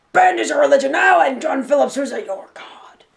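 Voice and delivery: band director proclaiming, high and loud, sinking to a whisper; Jack Hans. Retold or imitated. Ex-band member quoting the band director's creed, proclaiming. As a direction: preach it loudly, then hush the last words. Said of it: "something that our band director, Jack Hans, really said".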